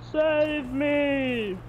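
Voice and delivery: high, drawn-out plea; crying for rescue. A narrator's reading. Man clinging to a board begs rescue, high drawn-out cry. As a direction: call out to be saved, pitched high. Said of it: "he cried".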